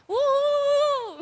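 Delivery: high whoop of joy; cheer. Pretend. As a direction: whoop like the boy leaping up. Boy jumps up cheering. (grito de alegria agudo)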